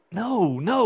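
high, disbelieving refusal; acted protest. Disciples hearing this for the first time, protesting. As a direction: play a disciple refusing the news. Disciples reject the shocking news.

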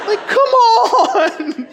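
very high, loud, drawn-out protest; long squealed comic complaint; exasperated wail. Retold or imitated. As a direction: wail it in mock despair. Exasperated at everyone laughing.

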